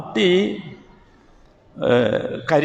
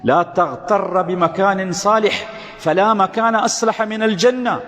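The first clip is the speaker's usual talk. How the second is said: slow Arabic recitation; 4 semitones higher, much slower than usual. Shown as a demonstration. Preacher recites Arabic saying.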